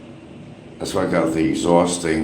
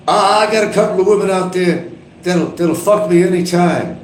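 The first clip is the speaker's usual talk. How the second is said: mock boast; 11 semitones higher than usual. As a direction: boast it pitched way up. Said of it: "Then he starts talking about".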